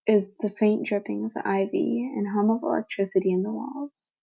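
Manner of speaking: plain reading voice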